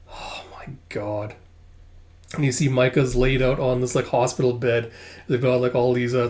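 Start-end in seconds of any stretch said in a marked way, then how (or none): 0.1-1.4 slow, hushed disbelief; stunned whisper. Real, not acted. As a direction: whisper it slowly in real shock. Reader's stunned reaction to the scene.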